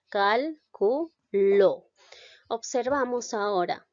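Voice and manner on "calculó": "Calculó" very slow and louder, split cal, cu, LÓ; drawn-out Calculó with each syllable apart, strongest on ló. Shown as a demonstration. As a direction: break it into cal-cu-LÓ, hitting the last. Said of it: "recién empiezo a silabear".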